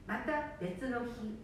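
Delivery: normal talking voice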